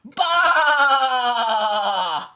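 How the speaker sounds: loud baa; sheep baa. A narrator's reading. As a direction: bleat loudly like a sheep. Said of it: "said Sheep".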